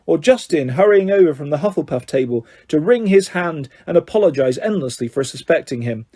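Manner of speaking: ordinary delivery, nothing marked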